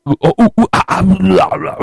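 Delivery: high-pitched mock caveman hoots; playful primitive babble; caveman gibberish. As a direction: imitate cavemen jabbering in grunts and hoots. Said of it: "it's all in caveman talk".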